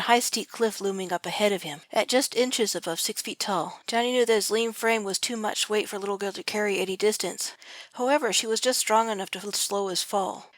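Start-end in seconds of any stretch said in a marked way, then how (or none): none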